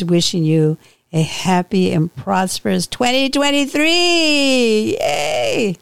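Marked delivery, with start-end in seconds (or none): 2.9-5.8 high, long drawn-out cheer; celebratory cheer. Real, not acted. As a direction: stretch it out into a happy New Year whoop. Host cheering the New Year.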